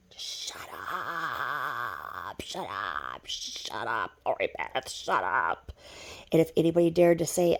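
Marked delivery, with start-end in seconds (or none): 0.1-5.7 very low, hushed growl, dragged out; seething shut up. Acted. Telling Lori Beth to shut up, seething low. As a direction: mutter it slowly, far under your usual pitch.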